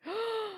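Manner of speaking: sharp theatrical intake of breath; mock shocked gasp. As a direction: gasp as if scandalised. Reacting to "a complaint.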